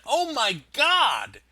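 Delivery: stunned by the wreckage; shocked exclamation. Acted. Barista sees the kitchen.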